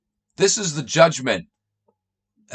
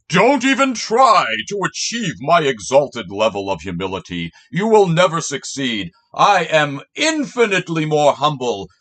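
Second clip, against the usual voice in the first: louder, pompous self-praise, mocking; mock boastful humility; 2 semitones higher, 5 dB louder than usual. Mocking Jesus by pretending to brag, pompous and loud. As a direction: ham up an arrogant bragging voice. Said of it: "It's like me saying".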